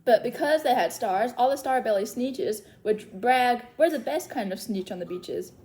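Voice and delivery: lively singsong read-aloud of a rhyming book; story reading to children. Acted. Librarian reads Seuss rhymes to little story timers, lively singsong. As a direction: read the rhymes to the kids with bounce.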